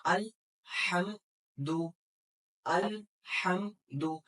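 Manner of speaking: अल, हम, दु split apart slowly; slow syllable drill of अल हम दु. Shown as a demonstration. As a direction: break अलहम्दु into separate syllables. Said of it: "कौन कौन से?".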